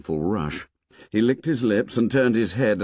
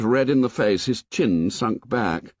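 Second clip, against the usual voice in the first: usual speaking voice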